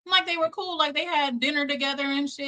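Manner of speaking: can't believe it; astonished outrage; incredulous. Real, not acted. Incredulous about him.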